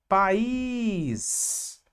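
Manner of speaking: País with a long held final ÍS; stress on the Í. Shown as a demonstration. Teacher showing the strongest syllable, País with drawn-out Í. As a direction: stretch out the Í in País. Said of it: "Qual é a sílaba mais forte".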